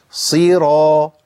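slow صيرا with clear ee, then open aa; drawn-out, distinct vowels in صيرا; full kasra. Shown as a demonstration. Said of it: "أسقط الفك وبعد كده أعمل انفتاح".

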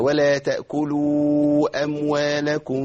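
chanted, أموالكم ending in a held hum with lips barely apart; slowly recite, humming the mim of أموالكم; long light ghunnah closing أموالكم; concealed mim. Shown as a demonstration. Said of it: "هنخلي الشفتين يبقى في بينهم فرجة".

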